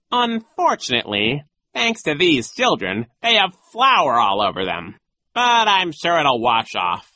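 put-on airs, blaming the children; theatrical villain. A narrator's reading. Disguised villain complains.

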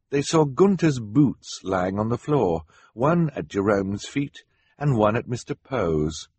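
plain reading voice

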